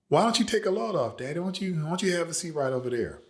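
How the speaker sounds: very high, fast, honeyed invitation; teen daughter baiting her father in a high voice; coaxing daughter. Retold or imitated. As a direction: lure him sweetly toward the stump, high and quick. Mother luring father to stump.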